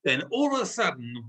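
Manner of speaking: ordinary delivery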